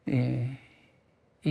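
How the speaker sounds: ordinary talk, nothing marked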